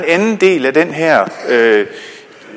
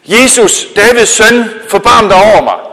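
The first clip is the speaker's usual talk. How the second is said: high, loud desperate shout to Jesus; a blind beggar shouts over the crowd to Jesus, loud and high; calling out; 6 semitones higher, 7 dB louder than usual. Acted. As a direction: cry out across the crowd for mercy. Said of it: "så råbte han".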